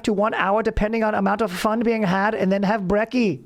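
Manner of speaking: high, formal German-Aussie surfer bro; German Australian dude voice. Pretend. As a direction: stiff German diction with surfer slang. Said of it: "It's my Australian German dude".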